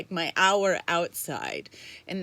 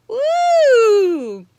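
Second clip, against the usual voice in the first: long, high, loud "woo"; excited whoop; 13 semitones higher, 13 dB louder than usual. Real, not acted. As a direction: whoop loudly and hold it. Whooping after greeting a viewer.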